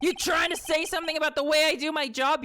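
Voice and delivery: high, indignant cop voice; offended detective. Acted. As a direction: bristle like an insulted detective. Detective bristles at being insulted.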